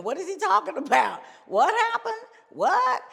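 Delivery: high, loud mock disbelief; baffled questioning. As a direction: act out stunned bandmates demanding answers. Bandmates' baffled questions in retold story.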